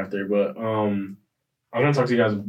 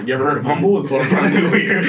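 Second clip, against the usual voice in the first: laughing; 5 semitones higher, 6 dB louder than usual. Real, not acted. Joking about being humble.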